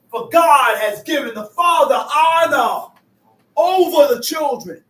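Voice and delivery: loud preaching boom on the verse; emphatic preaching. Acted. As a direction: proclaim it to the congregation.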